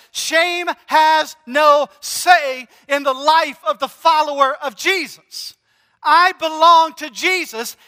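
shouting the congregation's worth in Jesus; preaching climax. Real, not acted. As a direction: proclaim it with full force.

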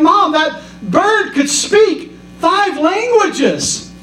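high and slow, spelling out the outrage; each word stretched in disbelief; aghast son. Retold or imitated. Son telling mom about the talking parrot, high, slow and aghast. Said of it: "He's like".

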